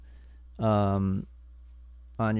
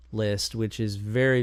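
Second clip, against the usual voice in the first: ordinary delivery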